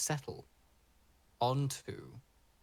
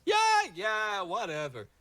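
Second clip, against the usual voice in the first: higher, louder, airy voice with lazy drawn-out words; breezy green man; 7 semitones higher, 4 dB louder, slower than usual. Acted. The green man answering the dryad, airy and offhand. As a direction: brush it off, then turn gracious and grand. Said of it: "And he goes".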